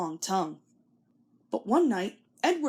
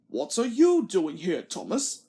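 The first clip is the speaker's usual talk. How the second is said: lightly voiced stern boss; Fat Controller; 2 semitones lower than usual. A narrator's reading. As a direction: Fat Controller questioning Thomas. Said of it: "he asked".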